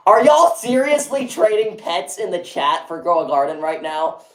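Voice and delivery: high-pitched disbelief; incredulous callout. Real, not acted. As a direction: call the chat out, astonished. Calls out chat for trading.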